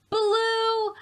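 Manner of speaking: high, loud joyful answer; excited reveal. Acted. Teacher answering her own question for the children, high and loud. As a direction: call out the colour with delight.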